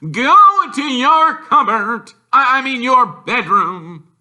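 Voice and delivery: loud, high, breathless bark; winded uncle gasping orders; wheezed. A narrator's reading. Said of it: "he wheezed at Harry".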